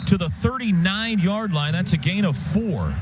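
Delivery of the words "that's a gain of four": usual speaking voice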